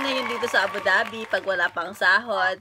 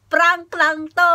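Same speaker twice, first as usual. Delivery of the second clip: slow, loud, then bursting into high laughter; laughing reveal; 7 semitones higher, 6 dB louder, slower than usual. Real, not acted. Vlogger tells friend it's a prank, laughing. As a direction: give away the prank and crack up.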